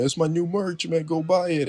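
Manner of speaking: lightly voiced hyped salesman; mock R-Truth. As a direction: push the merch cheerfully. R-Truth pushing merch.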